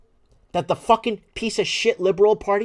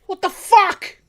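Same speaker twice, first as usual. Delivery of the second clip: higher, loud yell of disbelief; outraged yell; 12 semitones higher, 9 dB louder, much slower than usual. Real, not acted. Host repeating his outrage to listeners, loud outraged yell. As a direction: shout it again at full anger.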